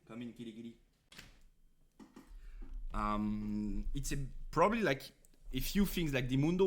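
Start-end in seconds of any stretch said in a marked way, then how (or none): 0.1-0.8 soft, hushed call to a cat; coaxing the cat. Real, not acted. Calling the cat back into the room, soft coaxing voice. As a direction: coax the kitty in gently and softly.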